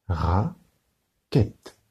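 Raquette, slow, ra and quette apart; split into syllables. Shown as a demonstration. Teacher to pupils, Raquette broken into syllables. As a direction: beat out Raquette in two. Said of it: "Un doigt pour rat".

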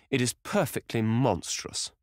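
a hint of feigned outrage, drawn out; mock outrage. A narrator's reading. Lord feigning outrage to amuse the table, slow.

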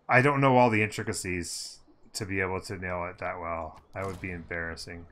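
ordinary talk

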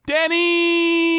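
high, long held ghost call; ghostly call. Acted. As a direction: wail the name. Spooky donor name call-out.

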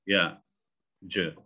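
ordinary talk

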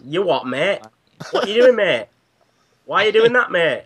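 high, exaggerated British "mate" voice; playful British protest; mock British accent. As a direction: put on a British lad's accent, rising in pitch. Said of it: "British sheriff".

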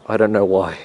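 usual speaking voice

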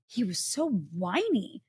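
drawn-out, mock-whiny swoop on whiny. Complaining about a politician's voice, mock-whiny. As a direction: stretch it into a slow mocking whine. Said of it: "that whiny Joe Lieberman voice".

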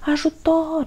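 very high call from the pit; desperate cry for help. A narrator's reading. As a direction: cry out in despair. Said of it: "strigă el disperat".

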